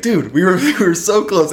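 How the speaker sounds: high-pitched, laughing classmate's lament; mock dismay. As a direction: whine it in playful disappointment. Acting disappointed classmates leaving.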